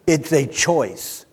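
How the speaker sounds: usual speaking voice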